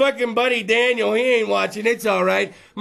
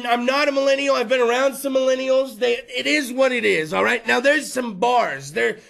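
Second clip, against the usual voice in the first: ordinary delivery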